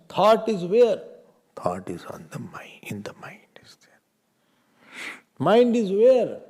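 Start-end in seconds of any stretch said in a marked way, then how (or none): 1.6-4.0 soft whispered reply; whispered answer. Real, not acted. Whispered answers to own questions. 5.4-6.4 high, loud, slow question; a teacher booming a question at his students. Real, not acted. Teacher loudly asks the class.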